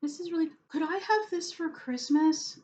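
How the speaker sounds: high-pitched little-sister voice, pleading; tiny little voice. Retold or imitated. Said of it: "she'd say in this tiny little voice of hers".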